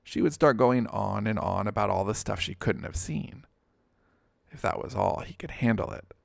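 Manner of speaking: usual speaking voice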